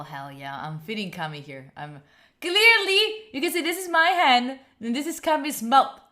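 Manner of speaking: usual speaking voice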